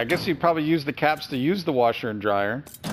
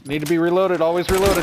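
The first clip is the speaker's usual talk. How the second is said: urgent; 5 semitones higher, 6 dB louder, faster than usual. Real, not acted. Scrambling to reload in fight.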